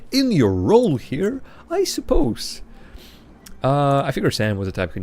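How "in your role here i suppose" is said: slow, then a high squeaky "I suppose"; mock silly phrasing. Shown as a demonstration. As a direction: ham up the prissy wording. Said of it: "but it's phrased really silly".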